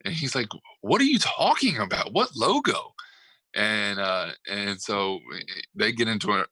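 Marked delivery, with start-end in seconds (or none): 0.8-2.9 high, indignant mock shout; imitated arguing. Retelling a player arguing back at the coach, indignant mock shouting. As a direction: throw it back in pitched-up disbelief. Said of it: "a shouting match and an argument pregame".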